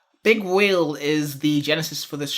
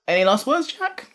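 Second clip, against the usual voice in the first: voice cracking up an octave; 9 semitones higher than usual. Real, not acted. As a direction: voice cracks high on Jack. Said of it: "my voice broke there".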